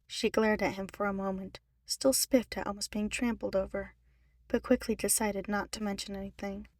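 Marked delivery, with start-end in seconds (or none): none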